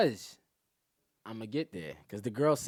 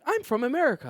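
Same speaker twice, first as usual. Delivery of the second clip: higher-pitched protesting imitation; mock indignant reply; mock insistence; 12 semitones higher, 3 dB louder than usual. Mimics immigrants insisting on being American.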